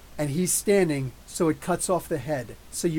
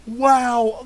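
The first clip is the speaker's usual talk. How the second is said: higher, louder, slow gasp of wonder; awed exclamation; 7 semitones higher, 7 dB louder, much slower than usual. Retold or imitated. Vlogger reliving the tiger plush scene, loud awed gasp. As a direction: let it burst out in awe. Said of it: "I'm like".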